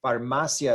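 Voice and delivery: "Farmacia" stressed on MA, ending in a quick "sya"; correct pronunciation. Shown as a demonstration. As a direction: say "Farmacia" in three syllables, far-MA-sya. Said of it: "how you actually pronounce that".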